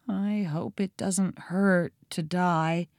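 put-on soft, fearful child; quiet dread. A narrator's reading. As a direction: say it softly, small and resigned. Captive boy facing death.

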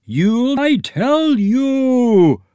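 very high, loud, slow bellow. A narrator's reading. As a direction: bellow like a pompous boss. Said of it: "bellowed Eggy Senior".

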